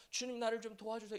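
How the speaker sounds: soft, polite request while drowning, played for contrast; meek mock plea. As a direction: ask quietly, as if drowning politely. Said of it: "이렇게 꼴깍꼴깍해서 물에 빠졌을까요?". (물에 빠진 채 얌전히 부탁하는 작은 목소리)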